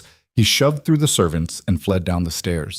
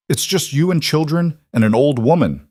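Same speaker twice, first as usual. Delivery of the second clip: snarling; 7 semitones higher, 3 dB louder than usual. A narrator's reading. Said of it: "Blackwood snarled".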